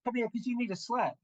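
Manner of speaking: high-pitched, affronted summons; indignant threat. Acted. Hireling calling Dash over for a slap, indignant. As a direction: threaten a slap in mock outrage.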